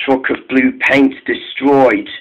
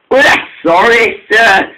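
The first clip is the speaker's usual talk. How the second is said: higher-pitched mock apology; sarcastic; 7 semitones higher than usual. A narrator's reading. Donald to the Fat Controller, sarcastic. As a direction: apologise without meaning a bit of it. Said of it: "said Donald sarcastically".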